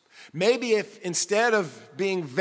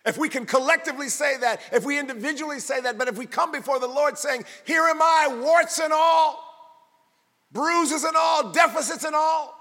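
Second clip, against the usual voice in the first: higher and louder, building sermon climax; full-force preaching; 6 semitones higher, 4 dB louder than usual. Acted. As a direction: preach it to the rafters.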